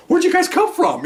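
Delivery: high-pitched, baffled question; astonished suspects. Retold or imitated. Surprised suspects asking officers where they came from, astonished. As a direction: imitate startled guys caught off guard.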